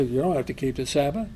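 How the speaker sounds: low, soft, quick scoffing; a doubter's low, quick dismissal; dismissive mock voice. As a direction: brush it off lightly, mocking others. Mocking what people say.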